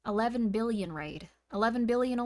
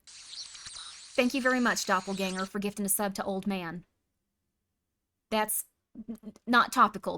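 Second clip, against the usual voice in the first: normal talking voice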